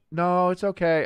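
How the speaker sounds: imitating a meek voice, pitched high; mock-timid, high-pitched reassurance; meek reply. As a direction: answer small and high from another room. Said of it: "I just hear very meekly".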